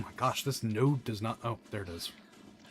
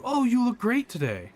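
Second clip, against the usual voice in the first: high-pitched, sugary fake compliment; fake niceness; 12 semitones higher, 4 dB louder than usual. Streamer mimics someone who is nice but not kind, fake sweet. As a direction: gush with put-on friendliness. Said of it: "pretends is nice, but is not kind".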